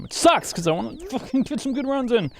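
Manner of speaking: very high, fast whine; high-pitched whiny gripe; whiny complaint. Real, not acted.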